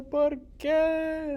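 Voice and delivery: high, loud put-on voice asking why; squeaky character voice, loud and high; man asking why.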